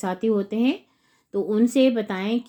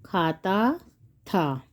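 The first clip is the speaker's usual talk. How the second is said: खाता drawn out rising, pause, था dropped low; slow dictation; 3 semitones lower, much slower than usual. Shown as a demonstration. Teacher writing out the answer, slow dictation.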